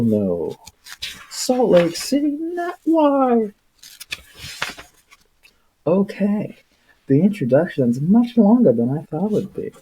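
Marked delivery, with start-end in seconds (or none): none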